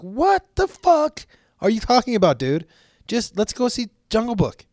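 high-pitched, exasperated mock outrage; retold disbelief. Eight-year-old answers his grandfather about sitting on nuts, mock disbelief. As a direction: protest in a high incredulous voice.

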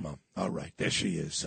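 choked up. Real, not acted. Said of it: "she makes me cry".

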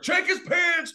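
high, loud mock call from the crew; imitating camera guys. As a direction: squeal it out as the excited crew. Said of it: "camera guys were like".

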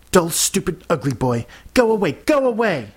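high, shouted dismissal; angry outburst. A narrator's reading. Upset girl telling the boy to go away, angry. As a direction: drive him off, pitch climbing to a cry.

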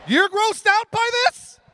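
high, loud outraged protest; indignant shout. Real, not acted. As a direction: throw the outrage back at the crowd. Defending his gross answer.

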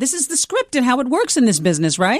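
high-pitched indignant quote; mock protest. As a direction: protest like a clueless rookie anchor. Voices a defensive young anchor protesting.

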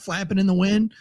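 normal talking voice

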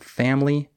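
family in two syllables, fam-lee; silent I. Shown as a demonstration. Teacher modelling the example word, family with silent I. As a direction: drop the middle vowel of family. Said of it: "the I is silent".

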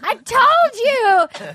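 high, wailing heartbroken suitor; mock crying. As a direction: draw out the last words in a hammed-up lament.

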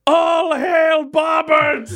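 slow, high herald's cry; hailing shout. Acted. Reginald hailing the king before the crowd, shouted acclaim. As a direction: stretch out a royal hail.

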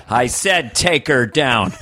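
slow, flat-out commanding drawl; John Wayne. Retold or imitated. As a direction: refuse to hear it, slowly. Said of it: "John Wayne not only had no fear".

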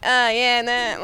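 a broad Australian oh yeah nah. Retold or imitated. Back beside her home friends, speaking broad Australian. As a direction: slip back into thick Aussie. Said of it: "I'm like".

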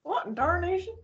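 put-on old prospector. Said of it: "a good old Southern word".

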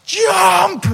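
loud, high shouted command in a put-on voice; shouted order. Director yelling at the actress to jump. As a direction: bark the cue to jump.

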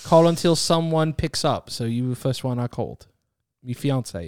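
put-on English accent, explaining. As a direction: keep up a casual English accent. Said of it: "have to speak with an English accent".

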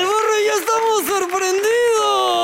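high, slow cartoonish voice; hammed-up silly voice; high slow comic voice. Comic voice line.

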